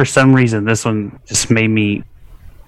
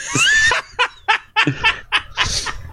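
high, wild shrieking laugh; cracking up with a howl of laughter; burst of laughter. Real, not acted. Friend cracks up at drunk-guy comment, shrieking laugh.

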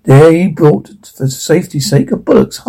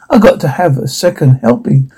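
usual speaking voice

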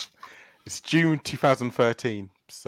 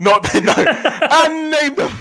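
laughing; 8 dB louder, slower than usual. Real, not acted. Teasing answer, laughing.

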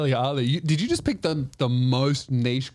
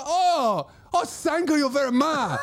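put-on high, gushing Chinese-accented thanks; high-pitched Chinese accent imitation; 12 semitones higher, slower than usual. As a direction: mimic a grateful Chinese lady's accent. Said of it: "do the accent for that story".

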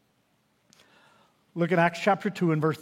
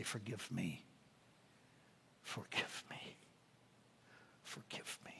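each plea softer until it is a whisper; fading plea; 11 semitones lower, 27 dB quieter, slower than usual. Acted. Preacher voicing his own plea for forgiveness, fading to a whisper. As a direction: let the prayer dissolve into a whisper.

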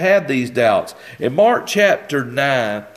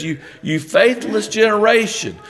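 "faithless generation" pitched higher, stressed on generation with a falling end; Jesus' rebuke; 7 semitones higher than usual. Acted. Preacher voicing Jesus scolding doubting disciples, higher. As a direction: rebuke them from a raised pitch.

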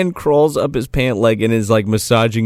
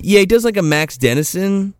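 ordinary talk

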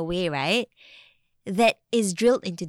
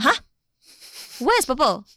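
high, louder baffled question; mock bewilderment, pitched up; puzzled child; 5 semitones higher, 6 dB louder than usual. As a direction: play a confused kid questioning a parent. Acted puzzled child answering parent.

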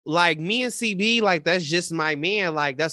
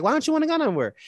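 pitched way up, baffled; puzzled high question; 10 semitones higher, much faster than usual. Retold or imitated. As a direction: ask it as if you can't believe him. Retelling a drunk argument.

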